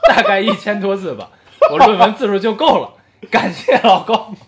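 reading through giggles; can't stop laughing mid-sentence; laughing while reading. Real, not acted. Listener letter, laughing while reading. (边笑边读)

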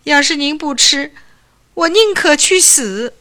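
put-on high, loud, mildly tearful young man's outburst; a hint of crying, begging the doctor; tearful plea. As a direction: cry out that you would rather die. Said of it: "哭起来了".

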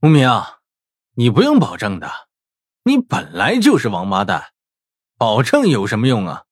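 unhurried, sneering classmate voice; leisurely taunt. A narrator's reading. Rival classmate mocking his promise, leisurely taunt. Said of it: "一道慢悠悠的声音传了过来".

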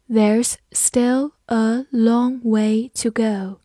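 slow, measured, word by word; slow, evenly spaced model reading; slow read-through. Shown as a demonstration. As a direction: read it slowly so learners can repeat. Teacher's slow read-along, then normal speed.

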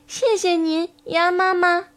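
put-on high, childlike thanks; little tadpoles. Tadpoles thanking mother duck, high little voices. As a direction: thank her in a small high voice.

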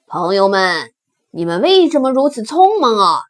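wild boar calling out loudly. Acted. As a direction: hail the fleeing crowd with a question. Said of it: "大声叫". (野猪大声喊问)

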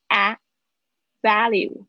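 "value" with the A as in "cat"; teacher models the A in "value"; clear A vowel. Shown as a demonstration. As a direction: isolate the "a", then say "value". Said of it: "注意一下你们的A的发音".

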